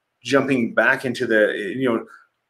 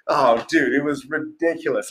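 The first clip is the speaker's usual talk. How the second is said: ordinary delivery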